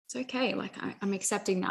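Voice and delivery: soft self-reassurance. Pretend. Gently accepting a bad day.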